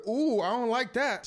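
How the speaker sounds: high, loud mock dismay; comic alarm. [name] reacting to the riled bodyguards, comically alarmed. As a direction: back off with a hammed-up yelp.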